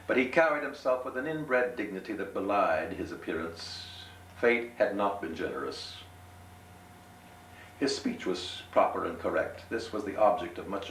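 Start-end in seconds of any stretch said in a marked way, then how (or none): none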